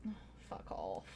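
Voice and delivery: very low, voiceless muttered curse; whispered curse. Real, not acted. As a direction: hiss it under your breath. Frustrated mutter.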